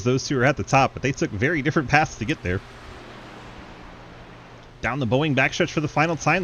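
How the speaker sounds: fast live race call; play-by-play. Acted.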